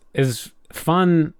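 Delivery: usual speaking voice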